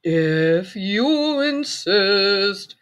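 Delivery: very slow drawn-out voice; praying mantis voice. A narrator's reading. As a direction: stretch it out slowly. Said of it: "said the praying mantis".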